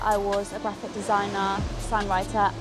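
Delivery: normal talking voice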